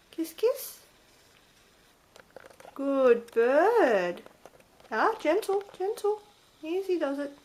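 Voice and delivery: high gentle coaxing. Real, not acted. Owner coaxing her bird to peck gently, high coo.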